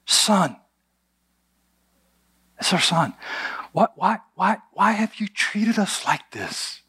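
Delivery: a worried mother's lightly played reproach; anxious mother. Mary scolding lost Jesus.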